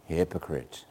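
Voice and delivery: hypocrites breathed soft and low; nice soft voice. Shown as a demonstration. Preacher mocking a gentle Jesus, soft. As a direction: say hypocrites gently, almost whispered. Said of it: "in a nice, soft voice".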